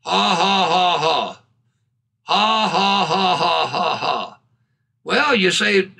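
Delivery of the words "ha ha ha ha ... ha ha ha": deliberate chain of spoken ha-has, sinking lower; imitated laughing. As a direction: act out a man laughing on purpose. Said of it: "started laughing".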